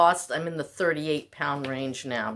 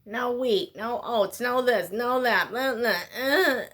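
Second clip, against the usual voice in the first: higher, louder sing-song mockery of nagging advice; mocking the rules; 5 semitones higher, 4 dB louder than usual. Mimicking diet advisers, scornful sing-song. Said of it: "I get so sick of being told".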